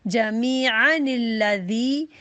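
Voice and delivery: जमीअनिल्लज़ी recited very slowly as one word with a linking nun; correct recitation. Shown as a demonstration. Teacher shows sisters the right reading, slow recitation. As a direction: draw out जमीअनिल्लज़ी, joining the nun. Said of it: "जमीअन नहीं बोलेंगे".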